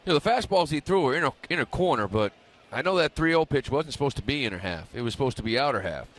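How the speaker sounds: ordinary talk, nothing marked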